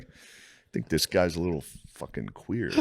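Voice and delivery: imitated dad. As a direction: impersonate Dad confiding his suspicion, slowly. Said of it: "My dad would talk".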